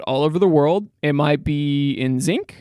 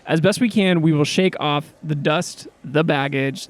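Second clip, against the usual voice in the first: ordinary talk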